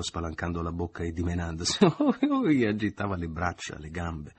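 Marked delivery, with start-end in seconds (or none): 1.8-3.2 very high, loud squealing laugh-cry; laughing shriek. A narrator's reading. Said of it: "ella gridò ad un tratto, ridendo".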